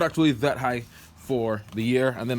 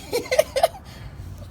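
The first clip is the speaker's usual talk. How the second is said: laughing. Real, not acted.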